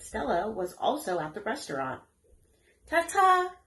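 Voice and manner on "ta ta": very high, louder sing-song farewell; calling goodbye. A narrator's reading. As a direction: call a cheery goodbye. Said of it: "Charlotte called as they left".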